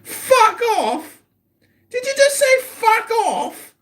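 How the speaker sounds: high, mock-shocked repeat of the insult; indignant disbelief. Real, not acted. Streamer repeating the caller's insult, in high mock-shocked disbelief. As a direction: act scandalised at being told off.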